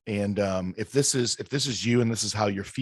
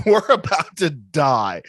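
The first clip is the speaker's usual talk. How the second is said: laughing through the line; cracking up while talking; 8 semitones higher, 7 dB louder, slower than usual. Real, not acted. Laughing about covid ride.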